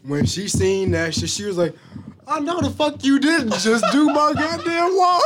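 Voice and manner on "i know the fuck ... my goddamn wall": high, furious mom shout; yelling mom, laughing through it; angry mom yelling. Retold or imitated.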